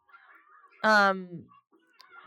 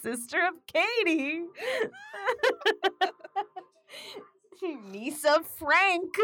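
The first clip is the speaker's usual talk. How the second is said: mock boastful; 8 semitones higher, slower than usual. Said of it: "me being like".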